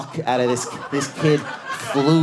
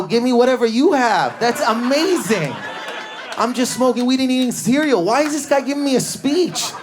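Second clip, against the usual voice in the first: incredulous shout; 13 semitones higher, 4 dB louder than usual. Retold or imitated. Teen's incredulous retort.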